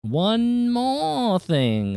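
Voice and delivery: very slow, high put-on drawl; drawn-out voice. Streamer drawing out a line in a funny slow voice. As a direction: stretch each word out slowly. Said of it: "gonna take that a little bit quieter".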